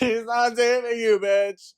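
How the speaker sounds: loud, very high squeal through laughter; laughing outburst. Real, not acted. Telling listeners he can't save you, laughing high and loud. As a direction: shriek it while cracking up. Same denial escalated, laughing.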